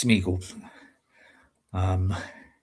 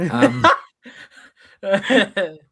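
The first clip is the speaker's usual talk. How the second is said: ordinary delivery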